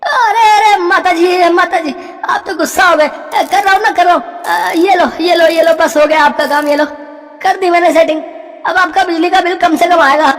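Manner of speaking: panicked wheedling to keep the deal; pleading electrician. Acted. Electrician calming the angry old woman, high and whiny.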